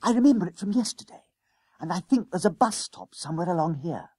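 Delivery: put-on high, eager boy's voice; schoolboy Jennings, conspiratorial. A narrator's reading. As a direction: confide the plan in a high schoolboy voice.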